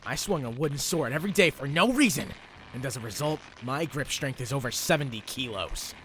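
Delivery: fighting boast. Acted. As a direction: brag hotly at the opponent. Subaru boasts mid-fight.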